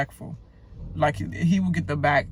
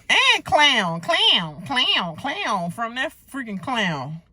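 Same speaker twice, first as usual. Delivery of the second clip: mocking repetition; 6 semitones higher, slower than usual. Real, not acted. Mocking repeated word.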